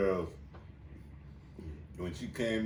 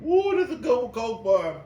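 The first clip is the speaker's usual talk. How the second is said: excited; 11 semitones higher, 8 dB louder than usual. Real, not acted. Savoring a drink.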